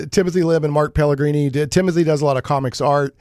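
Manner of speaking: usual speaking voice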